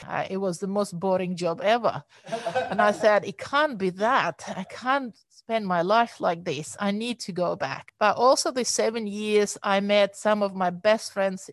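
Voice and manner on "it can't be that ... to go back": normal talking voice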